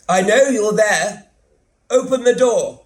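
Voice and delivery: high, loud shout through a door. Acted. Stranger shouting through the letterbox at Graham, loud and high. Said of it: "somebody shouts through the letterbox".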